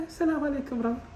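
soft, limp mock greeting of a feeble brother. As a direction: imitate a weak, timid handshake greeting. Mocking a limp handshake greeting.

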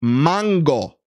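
"Mango" as Spanish "MAHN-go"; "Mango" said clearly with a Spanish a; Spanish accent. Shown as a demonstration. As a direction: demonstrate "Mango" with an open ah. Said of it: "with a Spanish accent".

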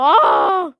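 sudden loud yelp of shock; startled shout. Real, not acted. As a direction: yell out in surprise. Startled by grandma.

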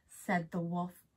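usual speaking voice

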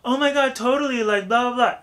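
put-on high, loud valley girl gush; "oh my God, totally" in falsetto valley girl. Shown as a demonstration. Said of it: "if you talk like a valley girl".

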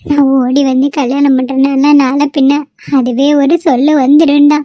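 usual speaking voice

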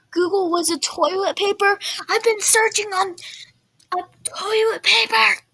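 high, loud disbelieving shout; shocked outcry. Real, not acted. Gamer shocked to find Google was toilet paper, shouting high. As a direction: blurt it out in outraged shock. Shocked outburst at search result.

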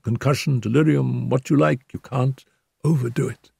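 injured Holmes. A narrator's reading. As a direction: speak up from the pillow, hurt but calm.

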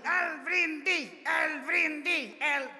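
slow, high drawn-out call; shouting from a corner. Retold or imitated. Guests shout for the toast from a corner, drawn-out call. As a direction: call the toast across the hall. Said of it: "empezaron de repente a gritar".

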